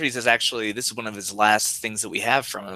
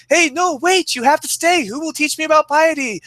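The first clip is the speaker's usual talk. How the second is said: much higher, fake-dismayed trolling; mock-pleading Socrates; 5 dB louder than usual. Socrates trolling as Euthyphro leaves, high fake dismay. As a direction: beg him to stay in a hammed-up high voice. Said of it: "Socrates going".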